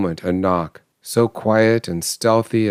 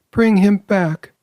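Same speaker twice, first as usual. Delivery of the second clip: high, louder desperate plea; desperate cry; 13 semitones higher, 4 dB louder than usual. A narrator's reading. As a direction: beg with all her might. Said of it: "cried the old woman".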